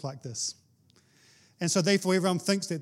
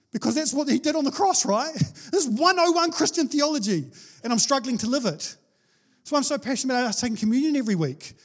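passionate preaching; 7 semitones higher, 3 dB louder than usual. Real, not acted.

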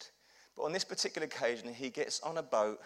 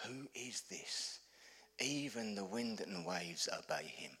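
slow, near-whispered amazement; hushed awe; 6 semitones lower, 10 dB quieter, slower than usual. Retold or imitated. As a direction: voice the stunned disciples under your breath. Awestruck disciples after storm stops.

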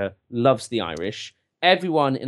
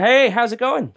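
high, hammed-up Irish accent, slow at the end; cheery stage-Irish lilt; 11 semitones higher, 5 dB louder, slower than usual. Shown as a demonstration. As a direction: pass as a charming Irishman in a bar. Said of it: "my Irish is passable to an American ear".

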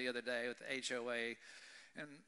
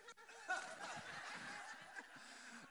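breathy laugh; 11 dB quieter than usual. Real, not acted. Laughs at the letter.

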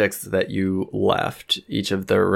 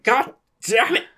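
high strangled squeal; high put-on exasperated voice; panicked cursing; 14 semitones higher than usual. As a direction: squeal the curse in comic dismay. Frightened character curses at spider.

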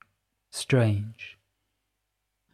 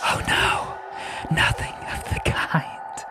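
chortling whisper; 4 semitones higher, slower than usual. A narrator's reading. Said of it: "Pyrope chortled".

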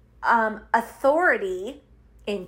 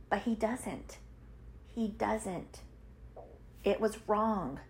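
hushed, much softer voice; sober admission; 11 dB quieter than usual. Real, not acted. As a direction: drop right down and admit it quietly. Sober admission about her father's error.

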